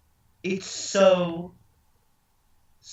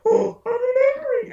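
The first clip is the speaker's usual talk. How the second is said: much higher, breathy mock quote; mock Donna voice; 3 dB louder than usual. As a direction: squeak it out like Donna. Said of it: "she's like".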